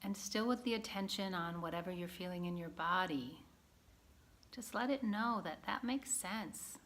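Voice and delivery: gentle guided-practice voice with long pauses; guided meditation. Acted. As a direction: guide a listener calmly inward, leaving space between phrases.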